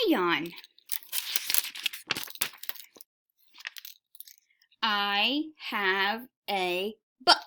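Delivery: put-on slow, word-by-word reading to young children; slow classroom read-aloud. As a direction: read each word carefully for beginners. Teacher reads sight-word book aloud.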